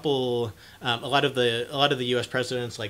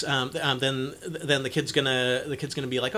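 ordinary delivery

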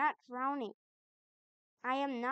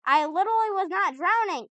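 louder, higher, fed-up insistence; exasperated protest; 6 semitones higher, 8 dB louder than usual. Real, not acted. Player arguing with the game about drowning, exasperated.

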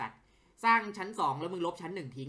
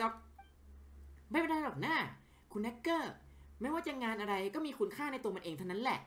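acted character dialogue, livelier than his own chat; voicing game characters; 6 semitones higher than usual. Streamer voices game characters talking with a journalist, acted. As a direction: play each character's lines as a scene.

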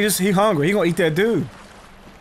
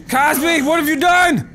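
high-pitched exclamation, building to a shout; mock outraged shout; 7 semitones higher, 3 dB louder than usual. Player confronting Cosby over what he has done, mock outraged shout. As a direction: cry out in mock horror.

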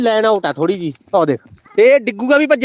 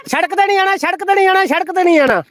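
calling out a warning; 7 semitones higher than usual. Real, not acted. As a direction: call them back from the road. Warning kids off the road. (ਉੱਚੀ ਸੁਰ ਵਿੱਚ ਚੇਤਾਵਨੀ)

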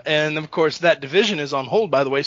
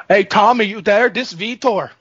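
higher-pitched, punchy mock accent; Vitor impression; 4 semitones higher, 4 dB louder than usual. Host calls to his buddy as the terrifying fighter, mock accent. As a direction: call out as a comic accented fighter. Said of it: "that's Vitor".

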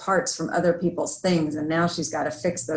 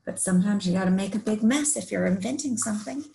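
ordinary delivery

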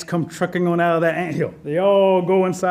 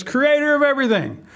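lightly voiced Rabbit, raised pitch; Rabbit calling the Creator; 6 semitones higher than usual. A narrator's reading. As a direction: call out to someone grand, a bit higher. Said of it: "walking up to the creator of everything and says".

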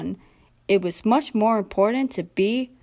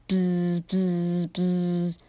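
three low, even buzzes; imitated buzzing; 3 semitones lower, 3 dB quieter, much slower than usual. A narrator's reading. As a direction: buzz like an approaching helicopter. Narrator imitates a helicopter's buzz.

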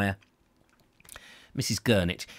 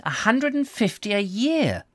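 disapproving; 11 semitones higher than usual. A narrator's reading. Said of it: "Doctor Trump observed disapprovingly".

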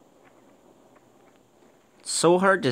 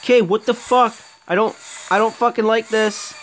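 high, tense nervous voice; rattled and uneasy; unnerved; 9 semitones higher than usual. Real, not acted. Gamer creeped out by the level, nervous.

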